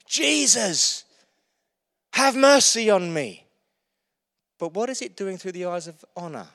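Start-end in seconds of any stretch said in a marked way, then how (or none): 0.1-3.5 raised, pleading call; a heartfelt cry for help; cry of faith. Retold or imitated. Beggar crying out in faith to Jesus, raised plea. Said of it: "he heard this cry of faith".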